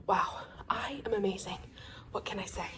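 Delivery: whispered mock bragging; playful whisper. Real, not acted. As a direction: brag softly, tongue in cheek. Jokey self-praise whispered.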